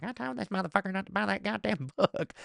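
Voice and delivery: high, soft wife imitation; mock the wife's scolding. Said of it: "My wife is walking by just going like".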